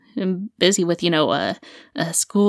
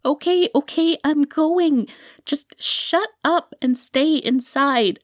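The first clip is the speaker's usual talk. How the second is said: high, fed-up giving in; harried, higher-pitched exasperation; exasperated surrender; 7 semitones higher than usual. Acted. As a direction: cave in irritably to shut someone up. Gives in to the nagging, annoyed.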